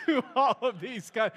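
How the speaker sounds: breaking into a real laugh mid-phrase. Real, not acted. Pastor laughing at his own slip to the congregation, laughing. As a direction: laugh through the words at a slip. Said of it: "that wasn't what I was expecting there".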